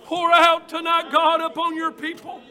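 loud, fervent preaching prayer at full force; shouted prayer. Real, not acted. Preacher crying out to God over the congregation, full-force shouted prayer. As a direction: cry out to God with everything you have.

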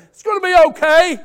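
high, loud, breezy comfort from outside; mock cheery reassurance; glib reassurance. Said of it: "easy for me to stand on the outside of that and say".

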